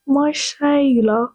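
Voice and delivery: put-on slow, drawn-out British accent; testing a British accent, very slowly; mock British accent. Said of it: "Is that how you say it in British? You'd be like".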